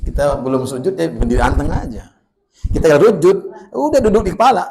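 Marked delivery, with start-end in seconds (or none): 2.6-4.7 higher, louder amused telling; lively telling. Real, not acted. Preacher tells mothers of child on his head, excited.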